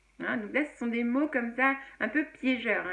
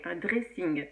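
"dressing" in a French accent, French r; English loanword pronounced the French way; 4 semitones lower, slower than usual. Shown as a demonstration. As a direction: say it as French speakers do. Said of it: "avec l'accent français".

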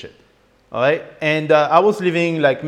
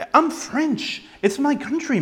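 exasperated protest; 8 semitones higher than usual. Retold or imitated. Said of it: "And I'm like".

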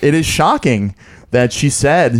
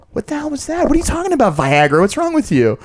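exasperated son; 11 semitones higher than usual. Retold or imitated. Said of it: "I pull my mom aside and I'm like".

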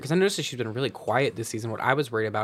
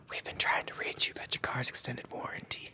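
hushed, voiceless mock whisper; imitated whisper; 10 dB quieter than usual. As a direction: whisper it like someone sneaking up behind. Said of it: "she is whispering".

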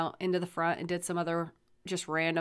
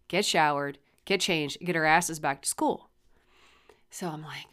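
ordinary delivery

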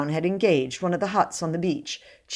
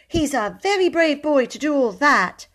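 indignant; 10 semitones higher, 4 dB louder than usual. Acted. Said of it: "she said indignantly".

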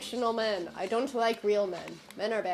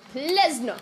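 pleading yell; 4 semitones higher, 7 dB louder than usual. Real, not acted. Chased in game, begging.

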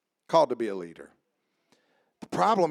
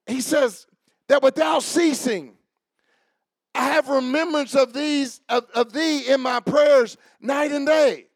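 raised pulpit voice, reading scripture out to the room; impassioned preaching; 11 semitones higher, 6 dB louder than usual. Real, not acted. Preacher reads Paul's prayer for Timothy, impassioned preaching. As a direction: declaim the verse, then press it home.

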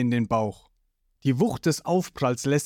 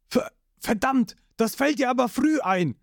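much higher, stammering outburst; startled Sanji; 9 semitones higher than usual. Acted. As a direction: caught off guard, annoyed.

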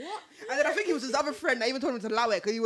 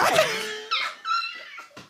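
shrieking laughter; squealing with laughter; high laughing shriek. Real, not acted. Laughing at the catcall.

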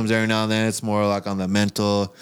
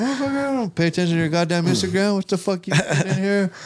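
pitched way up, mock-outraged yell; mock angry outburst; 10 semitones higher than usual. Friend confronted over spiked chocolate, mock furious. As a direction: ham up the outrage at your friend. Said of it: "I was like".

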